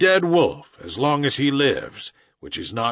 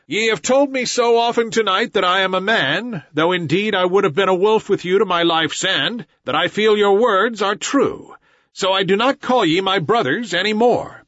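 raised, loud, rage and sorrow; furious boy; 8 semitones higher, 4 dB louder than usual. A narrator's reading. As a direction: cry it out with hurt fury. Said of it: "furious with rage and sorrow".

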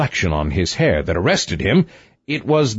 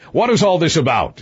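higher-pitched exclamation from the king; exclaimed question; 6 semitones higher, faster than usual. A narrator's reading. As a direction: demand to know what is going on. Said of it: "exclaimed he".

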